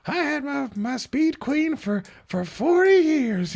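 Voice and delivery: put-on high, slow, quavery old lady; old woman voice. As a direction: play a frail old woman recalling decades. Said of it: "that's my old woman voice".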